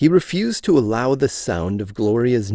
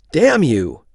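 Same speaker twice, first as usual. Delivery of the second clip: blurted out loud and higher; angry outburst; 5 semitones higher, 6 dB louder than usual. A narrator's reading. Hale cursing Johnson aloud, a sharp angry outburst. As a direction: spit the curse out at him. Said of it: "said Hale aloud".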